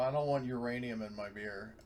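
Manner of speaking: ordinary talk, nothing marked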